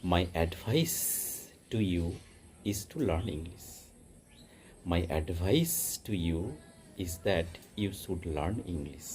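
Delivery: very slow example sentences, words spaced out; slow teaching read. Shown as a demonstration. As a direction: read it slowly and clearly for learners.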